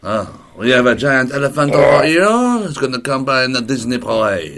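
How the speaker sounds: put-on French accent. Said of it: "French accents".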